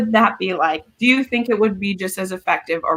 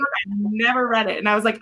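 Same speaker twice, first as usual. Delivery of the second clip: ordinary talk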